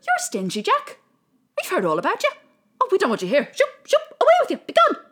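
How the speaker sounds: high, loud, shooing angel chorus; scornful angels. Acted. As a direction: sweep him away, haughty and shrill. Angels voiced at pearly gates.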